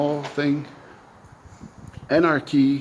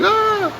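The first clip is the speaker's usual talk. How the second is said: short high-pitched startled "Ah!"; mock freak-out scream; 5 dB louder than usual. Imitating people hearing "We need anarchy", mock freak-out scream. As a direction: yelp like someone panicking at a scary word. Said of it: "they freak out, they're like".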